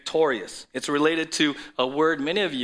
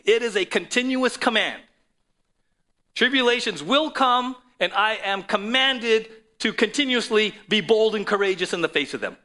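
preaching at full force; 8 semitones higher, 8 dB louder than usual. Acted. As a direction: build the sermon to a booming peak.

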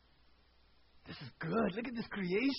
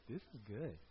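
softer, content murmur to yourself; quiet satisfaction; 11 semitones lower, 12 dB quieter than usual. Retold or imitated. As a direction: savor the finished table quietly. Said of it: "you look back and go".

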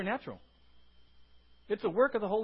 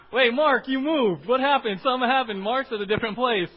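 louder, playful calling out across the room; teasing call-out; 3 semitones higher, 10 dB louder than usual. Real, not acted. Pastor teasing Mark for changing seats, loud and playful. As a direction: tease him for moving seats. Teasing a member who moved.

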